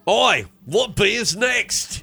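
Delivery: put-on Kiwi or Aussie accent. Host asking what beer is next in a mock New Zealand accent. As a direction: drawl it out like a cheeky Kiwi. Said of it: "Is that a New Zealand accent or Australian?".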